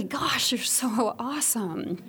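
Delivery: tender. Retold or imitated.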